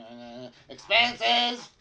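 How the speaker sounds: high, slow, loud squawk; gnome yelling. Acted. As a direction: drag it out in a high yell. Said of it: "And he's like".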